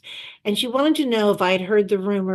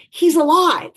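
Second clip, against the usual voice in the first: high, loud and delighted; excited announcement; 9 semitones higher, 5 dB louder than usual. Retold or imitated. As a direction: burst out with the good news. Announcing Bandar alive.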